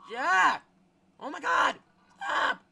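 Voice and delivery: terrified screams, voice cracking high; panicked screaming. Real, not acted. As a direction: scream in real panic at the chaser. Chased by monster in game.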